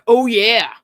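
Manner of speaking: loud booming Oh, yeah; Kool-Aid Man shout. Retold or imitated. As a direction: blurt it like the Kool-Aid pitcher. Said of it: "I say things like".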